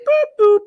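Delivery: high loud boop boop; phone dialing beeps. Retold or imitated. Host imitates someone dialing for [name], high beeps. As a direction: beep like dialing keys. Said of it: "Somebody was boop".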